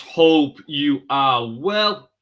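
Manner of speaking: loud, slow, sing-song hello; big, stretched-out morning hello to the audience; drawn-out greeting. Acted. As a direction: stretch every word like a radio host opening the show. Livestream opening greeting to viewers.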